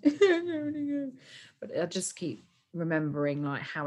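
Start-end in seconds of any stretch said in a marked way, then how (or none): 0.0-1.1 sad whine. Retold or imitated. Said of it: "I get so sad, I'm like".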